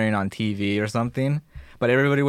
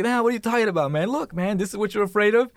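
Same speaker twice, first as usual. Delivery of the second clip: mocking; 11 semitones higher than usual. Cocky guy mocking the fear.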